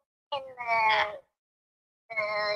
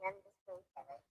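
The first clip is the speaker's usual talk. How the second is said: quiet aside; 12 semitones lower, 22 dB quieter, faster than usual. Real, not acted. Reassuring after an apology.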